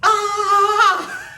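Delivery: high loud mock war cry; instructor's cry. Instructor to class, loud high whoop. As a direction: belt it out like a cycling instructor. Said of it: "And he said".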